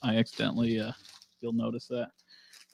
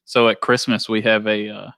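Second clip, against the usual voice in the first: normal talking voice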